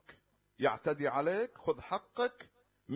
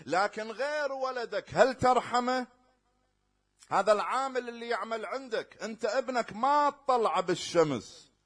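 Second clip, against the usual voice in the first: higher and louder, pressing preacher; preaching at full force; 5 semitones higher, 7 dB louder than usual. Acted. Preacher challenging the worshippers about their worker, loud and pressing. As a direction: hammer the question at the listeners.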